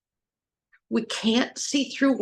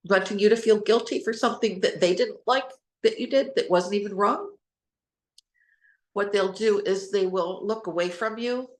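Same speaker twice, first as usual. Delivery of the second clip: ordinary delivery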